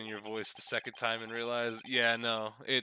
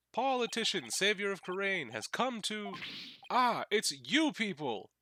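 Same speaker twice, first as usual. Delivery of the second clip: high, grandiose self-announcing politician; higher, loud pompous herald voice; 8 semitones higher, 4 dB louder, slower than usual. Acted. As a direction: proclaim yourself like a vain candidate.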